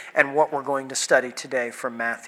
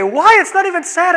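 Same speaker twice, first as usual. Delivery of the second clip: high, mock-baffled wife's voice; husband imitates wife puzzled that he cries at a happy film; 14 semitones higher, 7 dB louder than usual. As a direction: squeak out her disbelief as the wife. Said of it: "And she would say".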